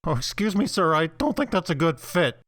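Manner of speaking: a fussy, disapproving waiter; snooty waiter. Acted. Assistant objects to boss.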